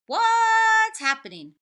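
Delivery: very high, very loud and slow; blaring. Acted. Tacky blaring at the hunters, very loud and high. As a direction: blast it out like a foghorn. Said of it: "blared Tacky".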